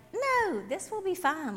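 lightly voiced grandma, high-pitched reassurance; breezy grandmother. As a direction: brush off the worry with a high, drawn "no". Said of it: "And Yaya's like".

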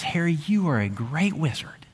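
whispered, like telling a secret; hushed whisper. Retold or imitated. Hagrid whispering the truth to Harry, awed whisper. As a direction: breathe it out in a whisper.

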